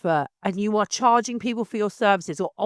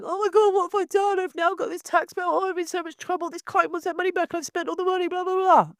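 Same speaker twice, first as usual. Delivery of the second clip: much higher, whiny mock panic of a struggling photographer; mocking whine; 10 semitones higher than usual. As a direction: parody their high-pitched moaning, hammed-up. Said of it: "whining on groups going".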